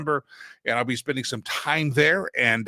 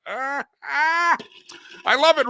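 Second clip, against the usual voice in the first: delighted; 12 semitones higher, 3 dB louder, slower than usual. Real, not acted. Delighted exclamation, high loud.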